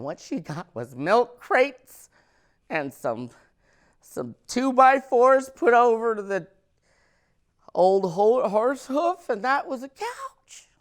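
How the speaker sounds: high, loud rising rant. Acted. Comic describing the horse-hoof couch, loud high rant. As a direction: rant it to the crowd.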